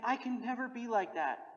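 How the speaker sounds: ordinary delivery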